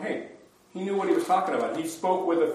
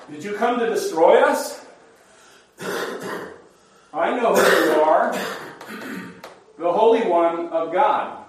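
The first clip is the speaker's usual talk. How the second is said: loud, emphatic outcry; crying out; 3 semitones higher, 5 dB louder than usual. Acted. Unclean spirit challenges the Holy One, crying out. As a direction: proclaim it loudly like an accusation. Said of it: "the man, cried out".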